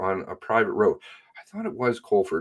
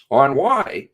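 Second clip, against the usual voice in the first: normal talking voice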